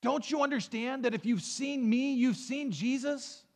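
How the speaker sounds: high, offended protest; mock offended. Preacher voicing a hurt believer, offended. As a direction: sound hurt at being asked. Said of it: "Would you say".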